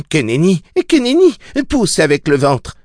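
high, urgent nurse's voice; infirmière qui exhorte. A narrator's reading. Nurse urges woman to push. (voix aiguë et pressante d'infirmière)

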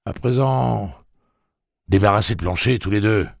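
loud, high, stern order. Acted. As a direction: order them off firmly. Susanna ordering them off.

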